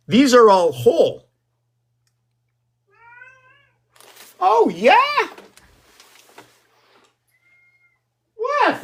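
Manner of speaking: high, loud, startled exclamations; excited shrieking. Real, not acted. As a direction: yelp it out in shock.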